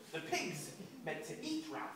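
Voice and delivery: plain reading voice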